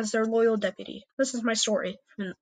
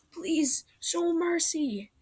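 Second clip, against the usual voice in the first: higher, slow, mild mock plea; begging; 8 semitones higher, much slower than usual. Surrounded leader mildly begging his attackers for mercy. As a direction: beg slowly for mercy. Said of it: "Applestar yelled".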